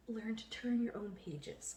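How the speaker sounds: slow, hushed mock-profound whisper; dramatic whisper. Co-host closing her book metaphor, slow dramatic whisper.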